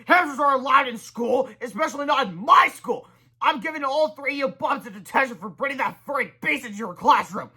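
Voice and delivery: high, loud bossy rant; high strident rant; bossy shouting kid. Acted. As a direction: scold like a tiny tyrant. Kid-principal lays down rules.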